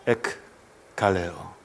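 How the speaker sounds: "Ekkaleo" drawn out very slowly, each syllable separate; Greek word spelled out. Shown as a demonstration. Pastor teaching the congregation a Greek root, drawn out.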